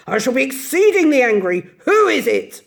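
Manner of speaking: loud, grumbling old badger voice; a disturbed badger warning at the door; gruff badger. Badger grumbling at late visitors, gruff and loud. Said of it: "said a gruff voice".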